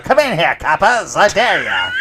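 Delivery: put-on high, loud old-movie gangster snarl; 1930s gangster. Mom at the window taunting the cops, gangster voice. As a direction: play a cocky thirties mobster daring the cops. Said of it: "your mom's like a nineteen thirties gangster".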